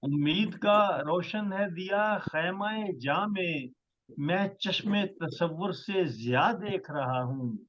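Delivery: measured, stately poetry recital; ghazal recitation. Acted. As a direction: recite each line with weight. Poet recites his ghazal.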